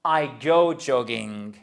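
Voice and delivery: I go jogging said slowly, word by word; slow model phrase. Shown as a demonstration. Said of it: "Vous dites". (lent et appliqué, modèle à répéter)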